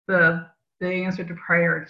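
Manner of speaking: usual speaking voice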